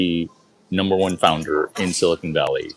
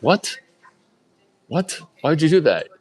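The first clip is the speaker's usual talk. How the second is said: higher, louder astonished what; shocked what; 9 semitones higher, 3 dB louder than usual. Retold or imitated. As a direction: bark it in disbelief. Author shocked.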